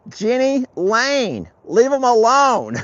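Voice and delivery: high, loud, drawn-out holler. Real, not acted. Rancher telling cow to leave birds alone, hollering. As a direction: shout it slowly across the pasture.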